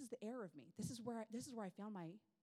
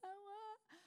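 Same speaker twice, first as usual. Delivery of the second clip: high, softer imitated wailing; mock toddler crying; 13 semitones higher, 5 dB quieter than usual. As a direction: imitate little kids wailing. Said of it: "so many tears".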